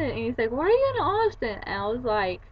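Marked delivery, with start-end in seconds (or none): 0.5-1.6 much higher, puzzled question; imitated puzzled dad. Dad questioning daughter on the phone, puzzled surprise.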